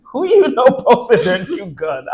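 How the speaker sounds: can't believe what she just heard; real shocked disbelief at a gun post; incredulous. Real, not acted. Incredulous at her friend's claim.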